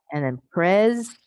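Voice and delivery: "pres" said alone, loud and slow; stress on PRES. Shown as a demonstration. Teacher stresses the first syllable for the class. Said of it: "emphasize the proper syllable".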